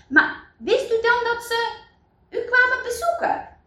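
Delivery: very high, astonished question; incredulous questioning. Acted. Villagers asking Eli about the visitors, astonished. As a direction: press him in amazed disbelief.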